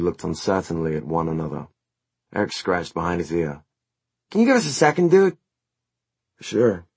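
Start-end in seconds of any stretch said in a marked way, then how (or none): 4.3-5.4 very high-pitched dude request; bandmate Eric. A narrator's reading. Plays Eric in the story. 6.4-6.8 lightly voiced surfer dude; a hint of surfer drawl. A narrator's reading. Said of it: "more surfer than southern".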